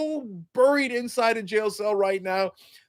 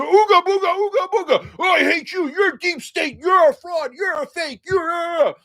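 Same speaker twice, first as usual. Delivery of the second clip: much higher, louder mock ranting; ranting Trump caricature; 11 semitones higher, 4 dB louder than usual. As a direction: rave like a caveman at the judge. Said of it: "He's gonna go".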